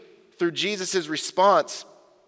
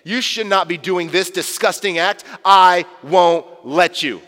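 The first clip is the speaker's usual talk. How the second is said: louder, slowing to a firm refusal; belligerent Peter; 5 dB louder than usual. Pretend. Peter refusing to let Jesus wash his feet, mock belligerent. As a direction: Peter putting his foot down. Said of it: "Peter gets more belligerent".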